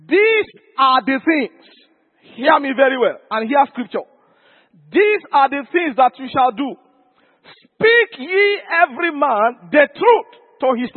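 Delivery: emphatic, drawn-out scripture proclamation; forceful preaching. Acted. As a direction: preach it slowly, driving each key word home.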